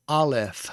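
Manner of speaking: "aleph" com f fricativo; fricative f. Shown as a demonstration. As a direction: sopre o f em "aleph". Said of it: "it is the fricative sound".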